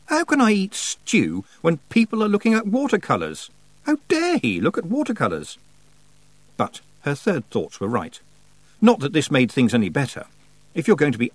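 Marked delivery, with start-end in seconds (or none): 0.1-5.6 high-pitched, outraged questions; indignant outburst. A narrator's reading. Tiffany too jealous to eat stew, indignant. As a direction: protest hotly, voice riding high. Jealous girl rants about watercolours.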